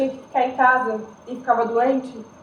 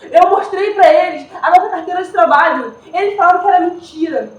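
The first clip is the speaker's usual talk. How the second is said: louder and higher, urgent protest; telling the police he was a worker, in raised desperate pleading; 4 semitones higher, 7 dB louder than usual. Acted. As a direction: plead for his life, voice rising. Pleading with police over André.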